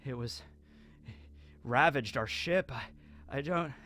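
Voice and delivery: weird trance, soft and halting; Muchigoro. Retold or imitated. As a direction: drift through it haltingly, breaking into a whimper. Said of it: "begins to talk in, like, a weird trance".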